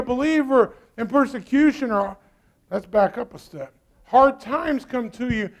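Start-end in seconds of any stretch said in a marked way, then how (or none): none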